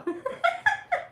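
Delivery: real laugh at the memory; high laugh. Real, not acted. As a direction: burst of high-pitched laughter. Laugh after dad quote.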